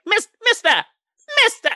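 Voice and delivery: very high, loud mock Karen; hammed-up high-pitched summons; imitating Alana's indignation. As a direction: call out in a piercingly high pitch. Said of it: "That is exactly how she sounds".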